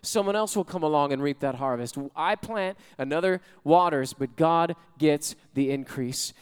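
ordinary delivery

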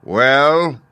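loud, high, drawn-out word; booming Great Head. A narrator's reading. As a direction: boom it imposingly.

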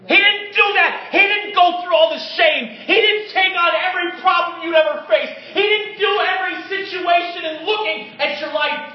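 loud, high, driving sermon voice; fiery preaching. Acted. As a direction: preach it out with full force. Preaching at full force.

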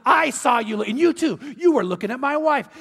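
jealous husband accusing the men; jealous accusation. Retold or imitated. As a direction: point at each of them accusingly.

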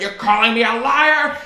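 high, loud villainous outrage; cartoon villain. Librarian challenging a pupil's denial, villainous outrage. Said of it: "like a cartoon villain's voice".

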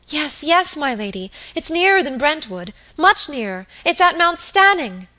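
high, urgent, agitated voice; frantic insistence. A narrator's reading. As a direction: insist in mounting alarm. Frightened maid insists fire is near.

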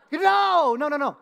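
high, loud blurted no, then easing; exasperated dad. Retold or imitated. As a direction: re-enact a parent's alarmed correction. Dad reacting to son's wrong answer.

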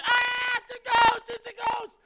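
high shouted ghost panic; hammed-up panic in the room; mock terrified. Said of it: "grown men running around".

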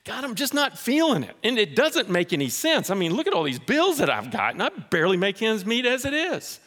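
whiny excuse-maker. Retold or imitated. A reluctant giver whining to God about bills, high and plaintive. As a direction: plead your bills in a high whine. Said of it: "Scripture says generously and cheerfully".

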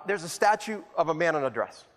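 ordinary delivery

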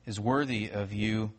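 soft, low, unhurried prayer voice; hushed pulpit prayer. Acted. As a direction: pray quietly and reverently. Pastor prays before sermon.

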